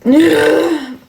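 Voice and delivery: high, loud-ish whiny sigh; exasperated groan. Real, not acted. Live streamer fed up with viewers' questions, exasperated groan. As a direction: groan in exasperation at being asked. Weary of questions about her man.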